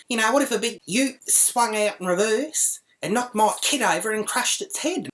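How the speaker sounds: high-pitched outraged imitation; high, affronted what-if; mock indignant mum. Mimics the indignant other mother.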